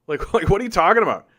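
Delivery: fast, laughing through "what are you talking about"; laughing disbelief. Real, not acted. Laughs at chat's claim.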